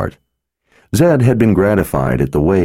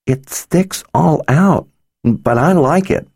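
put-on high, slow, earnest child voice; serious little girl; 11 semitones higher, slower than usual. Little girl to the wizard, high and earnest. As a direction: say it like a solemn small girl.

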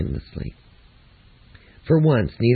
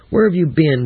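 pointed, suspicious question; sharp demand; 6 semitones higher, 6 dB louder than usual. A narrator's reading. Mother waiting, checking the clock.

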